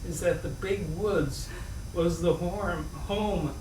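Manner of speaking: ordinary delivery, nothing marked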